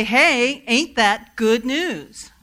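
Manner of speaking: loud, slow, stretched-out AIN'T, falling at the end; rousing call and response. Shown as a demonstration. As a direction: shout it back to the preacher with joy. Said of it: "and you say".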